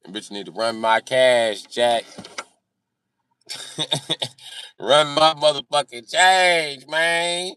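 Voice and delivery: ordinary talk, nothing marked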